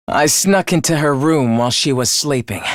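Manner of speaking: low-pitched, chilling murder confession; dark, low gloating; cold gloating. Acted. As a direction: recount the kill in a low, cold voice. Killer gloats over the murder.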